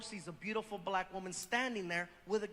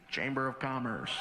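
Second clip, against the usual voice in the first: much lower, stiff official voice; fake official voice; 11 semitones lower than usual. Disguised deep voice at door.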